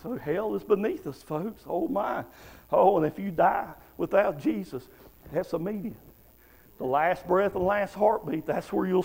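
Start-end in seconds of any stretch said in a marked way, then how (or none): none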